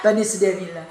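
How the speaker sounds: usual speaking voice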